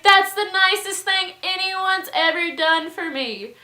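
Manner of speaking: high, loud mock gush; gushing gratitude. Drunk host thanking apologetic neighbors, gushing. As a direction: imitate a touched drunk host.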